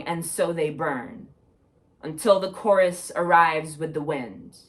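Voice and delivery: projected, measured spoken-word delivery; poetry reading. Acted. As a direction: recite it like a poem to an audience. Reads own poem aloud.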